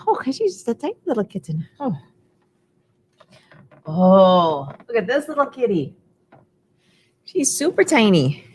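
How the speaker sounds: usual speaking voice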